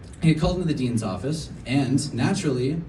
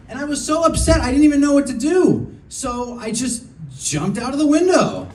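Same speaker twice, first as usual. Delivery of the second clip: mock outrage; 8 semitones higher than usual. Real, not acted. Punchline delivered in mock upset.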